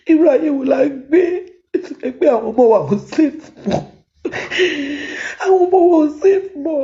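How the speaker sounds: sobbing lament that rises as it breaks; crying. Real, not acted. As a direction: cry out in despair. Mother breaks down about unsafe children.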